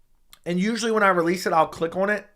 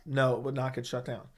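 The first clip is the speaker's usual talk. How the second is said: ordinary talk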